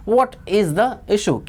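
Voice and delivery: very slow, each word drawn out; slow drill. Shown as a demonstration. Teacher drilling a question for students, very slow. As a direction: say it slowly so students repeat. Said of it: "क्या मामला है".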